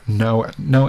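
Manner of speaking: ordinary talk, nothing marked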